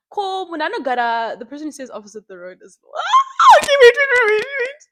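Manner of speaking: loud startled yelling at the neighbor; sudden loud alarmed yell; real shout. Real, not acted. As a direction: shout wait at someone outside.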